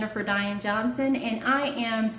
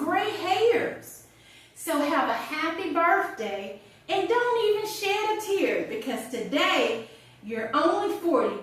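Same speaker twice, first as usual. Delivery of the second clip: lilting, higher-pitched verse reading; comic poem recital; 6 semitones higher, slower than usual. Acted. Writer reading her birthday poem to a friend, comic recital. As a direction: recite it with mock-consoling relish, landing each rhyme.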